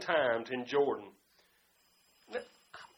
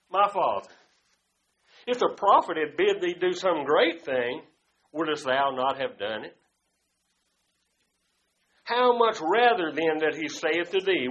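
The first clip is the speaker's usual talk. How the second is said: forceful preacher storytelling; loud preaching narration; 3 semitones lower, 7 dB louder than usual. Acted. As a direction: tell the scripture story full force.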